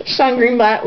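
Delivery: normal talking voice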